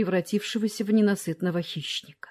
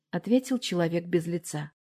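usual speaking voice

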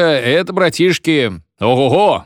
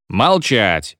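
ordinary delivery

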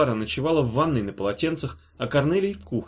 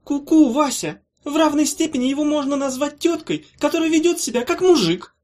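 high, loud mocking witch; snorting retort; 5 dB louder than usual. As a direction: snort back a cheeky comeback. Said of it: "фыркнула Улита".

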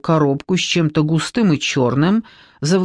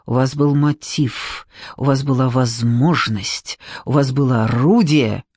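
slow, lower accusing list; 4 semitones lower than usual. A narrator's reading. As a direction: press each point slowly and heavily. Investigator lists her accusations. (обвиняюще, медленно)

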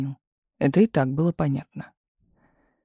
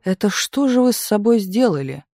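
higher, appalled exclamatory question; 5 semitones higher than usual. A narrator's reading. Hairdresser to Galina at her head, appalled. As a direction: react with mild horror. Shocked hairdresser scolds his client.

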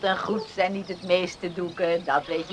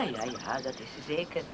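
soft, lower, distracted yes; a touch of absent agreement; 9 semitones lower, 16 dB quieter than usual. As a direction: agree half to yourself. Mother agreeing, lost in thought. (zacht, afwezig instemmend)

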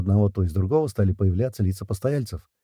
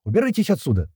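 much higher, raised roar; enraged owner roaring at the men; 8 semitones higher than usual. A narrator's reading. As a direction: bellow them off the premises. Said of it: "взревел Архи".

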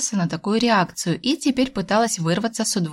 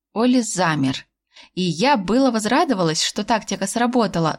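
usual speaking voice

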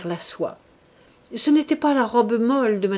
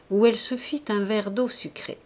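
plain reading voice

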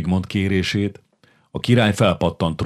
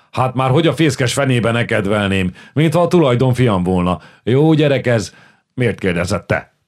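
hearty indignant protest; 5 semitones higher, 4 dB louder than usual. Acted. Csupor protests heartily, loud.

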